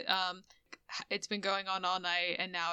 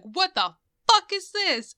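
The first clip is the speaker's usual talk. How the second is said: high, loud panicked yelp; freaking out; 11 semitones higher, 11 dB louder than usual. Retold or imitated. Retelling Metek's panic as the mermaid turns to foam, a loud, high freaked-out yell. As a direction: shriek it like you've just seen your lover dissolve into foam. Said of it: "like, freaking out".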